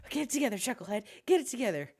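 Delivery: high, fast panicky self-talk; panicking guard. Acted. The clerk Chucklehead runs back panicking, fast and high. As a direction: scold yourself in a rush as you flee. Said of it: "And he's like".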